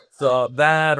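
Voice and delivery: ordinary talk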